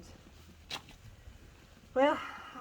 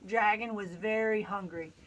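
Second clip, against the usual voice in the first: usual speaking voice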